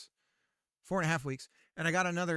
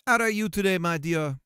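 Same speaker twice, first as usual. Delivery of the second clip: loud, hammed-up Jamaican scammer; terrible Jamaican accent; 6 dB louder than usual. Scammer greeting their mark, mock Jamaican accent. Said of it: "my terrible Jamaican accent".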